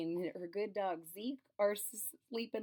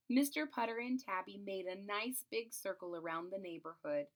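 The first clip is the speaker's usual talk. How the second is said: ordinary delivery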